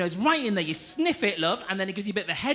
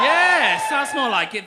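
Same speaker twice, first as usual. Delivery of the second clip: high, loud cheer back at the crowd; triumphant shout; 7 semitones higher, 11 dB louder, much slower than usual. Real, not acted. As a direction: shout your approval over the applause. Answers the audience's cheer.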